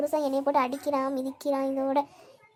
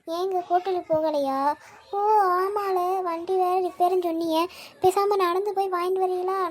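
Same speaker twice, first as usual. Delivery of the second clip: normal talking voice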